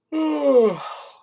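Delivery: loud open-mouthed yawn; long tired yawn, high and loud. Real, not acted. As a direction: let a big real yawn out. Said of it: "Sorry for yawning so much".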